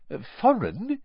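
high, baffled echo; puzzled. A narrator's reading. Mister Weasley echoing the owner, puzzled and high. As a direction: repeat it, lost.

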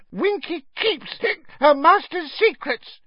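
very high, defiant squeak; hiccuping little elf digging in; mutinous elf. A narrator's reading. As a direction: refuse stubbornly in a tipsy elf voice. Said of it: "she said mutinously".

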